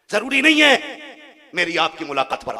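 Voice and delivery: high, forceful shout; emphatic loud repeat. Shown as a demonstration. Preacher warning the crowd they may not meet, emphatic shout. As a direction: hammer "जरूरी नहीं है" out loudly. Same phrase repeated loudly.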